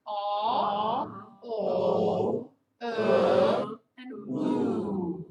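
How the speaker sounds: aw, o, uh, oo each held loud and rounded; vowel sequence. Shown as a demonstration. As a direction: sustain each back vowel in turn. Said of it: "let's do it again".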